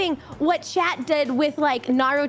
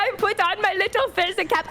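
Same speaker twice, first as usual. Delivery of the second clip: higher lightly put-on boast; playful put-on voice; 6 semitones higher than usual. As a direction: ham up a proud traveler. Playing a German stereotype.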